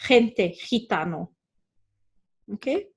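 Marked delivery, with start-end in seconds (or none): 0.1-1.3 gente, gitano with a throaty H for the G; G in gente, gitano as Spanish guttural J. Shown as a demonstration. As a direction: rasp the first sound of gente and gitano. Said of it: "we are always gonna hear it".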